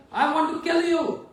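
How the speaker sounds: enraged Parshuram screaming at Lakshman; furious sage. Acted. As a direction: yell the threat.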